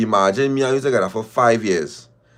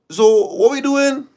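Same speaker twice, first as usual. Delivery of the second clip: incredulous; 13 semitones higher, 4 dB louder, slower than usual. Acted. Incredulous at partner not ready.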